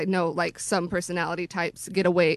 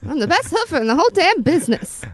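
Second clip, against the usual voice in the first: put-on old-timey hoofer; 7 semitones higher, 4 dB louder, faster than usual. Old-timey slang bravado.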